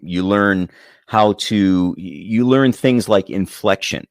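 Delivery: ordinary delivery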